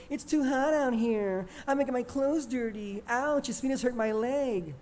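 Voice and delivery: mocking, high whiny voice of the cousins; high-pitched mimicking. Juan mimicking the California cousins' complaints, high-pitched mockery. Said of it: "mimicking them in a high-pitched voice".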